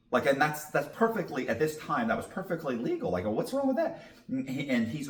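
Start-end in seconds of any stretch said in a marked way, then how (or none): 3.3-4.0 much higher, laughing fake innocence; a chuckling, wide-eyed innocent question; mock innocent. Suspect plays dumb to the detective, laughing mock innocence.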